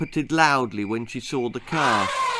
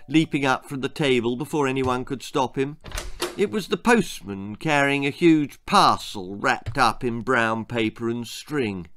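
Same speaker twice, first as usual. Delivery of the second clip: ordinary delivery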